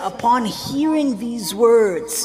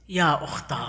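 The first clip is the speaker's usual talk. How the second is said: soft grieving call; 7 semitones lower, 9 dB quieter than usual. Acted. Hussein's last word to sister.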